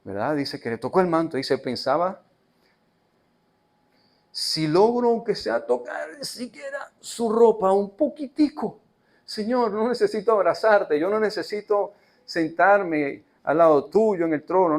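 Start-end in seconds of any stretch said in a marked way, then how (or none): 4.3-8.8 high, slow pleading voice of the sick woman; the woman's hopeful inner plea, voiced high. 9.2-14.8 slightly raised, slow earnest plea; lightly voiced woman begging the Lord; a hint of pleading woman.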